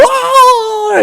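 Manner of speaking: very high, wailing mock scream; imitated scream. A victim screaming as the beetles get him, high mock shriek. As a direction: shriek like a man being eaten alive. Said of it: "And you see him go".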